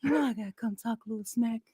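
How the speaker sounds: a hushed take on Shady's voice; soft, sly voice of a fan; imitating Shady. Co-host doing Shady's chat line, a light hushed impression. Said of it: "You gotta do it in his voice".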